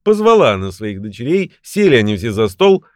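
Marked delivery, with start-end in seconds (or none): none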